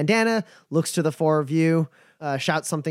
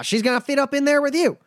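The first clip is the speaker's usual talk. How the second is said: higher, folksy drawling caravan hand; a wary but friendly trail boss sizing folks up; nomad Bumper; 10 semitones higher, 3 dB louder, faster than usual. Acted. GM voices Bumper.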